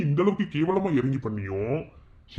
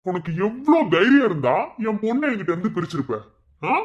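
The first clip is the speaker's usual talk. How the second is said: angry; 6 semitones higher than usual. Acted. Father confronts wife over daughter.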